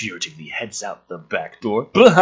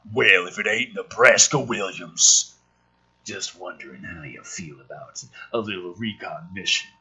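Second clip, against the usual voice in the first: put-on gruff old sergeant; 6 semitones lower than usual. Voicing grizzled Gunny.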